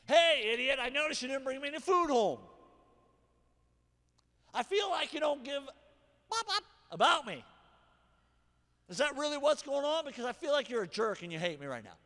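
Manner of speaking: loud, high and accusing; the wrong way, shouting insults; attack mode. Shown as a demonstration. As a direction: snap at him like you're fed up. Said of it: "This is how not to do it".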